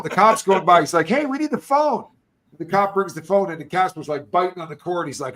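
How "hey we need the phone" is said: retold shout. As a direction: call it out even higher to the cop. Yelling for the phone again.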